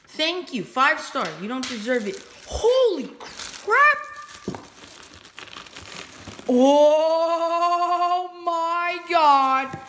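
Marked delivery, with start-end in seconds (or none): 2.5-9.8 high, drawn-out cry of delight; holy crap, real thrill; excited shout. Real, not acted. Opening long-awaited figure box.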